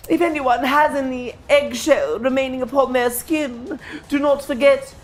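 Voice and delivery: high, preening satyr; vain satyr voice. Acted. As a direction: play the smug creature host.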